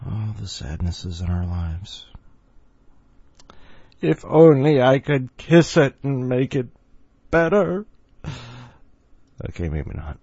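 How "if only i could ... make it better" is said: mock cooing. Mock sympathetic coo.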